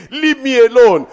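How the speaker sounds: high, loud mocking scream. Preacher mocking fearful believers screaming at spirits.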